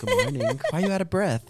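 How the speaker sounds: high, startled good morning; mock surprise. Husband greeting his out-of-breath wife, mock surprised. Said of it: "I'm like".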